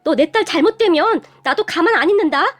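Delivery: high-pitched, frantic pleading turning to a threat; mother's yell. A narrator's reading. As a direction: cry out in alarm to stop a fight. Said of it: "엄마가 소리를 질렀지만". (다급하게 소리치는 엄마 말투)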